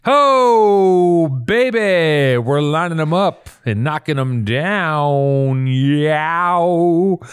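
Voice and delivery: loud, high whooping hype, slowed; hammed-up cheer. Host hyping himself after the duck joke, loud whooping. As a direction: whoop it up like a showman.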